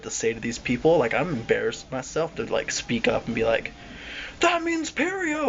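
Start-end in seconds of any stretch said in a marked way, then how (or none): 4.4-5.5 high, drawn-out mock scream; acted inner scream. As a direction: scream it as if only in your head. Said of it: "I'm screaming that in my mind".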